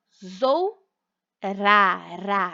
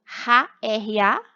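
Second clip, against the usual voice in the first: usual speaking voice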